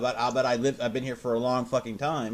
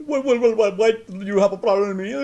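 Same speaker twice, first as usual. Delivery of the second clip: high, loud stuttering challenge; mocked service rep; 8 semitones higher, 7 dB louder than usual. Service rep challenges a caller, high mock indignation. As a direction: caricature an indignant rep, pitched way up.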